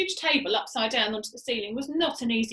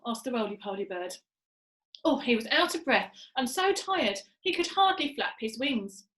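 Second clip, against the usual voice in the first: ordinary delivery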